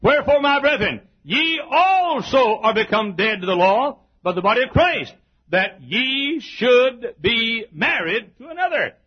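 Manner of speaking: high, loud pulpit recitation; ringing, emphatic Bible reading; declaimed scripture. Real, not acted. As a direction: proclaim the verse with full preaching force. Reads scripture with preaching force.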